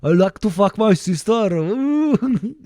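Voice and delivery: high-pitched bad Swedish accent; hammed-up Swedish, rising into an ooh; badly faked Swedish accent. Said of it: "I can't do Swedish".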